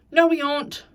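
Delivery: loud protest with mouth full; shouting. A narrator's reading. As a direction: shout him down. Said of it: "shouted the Mole".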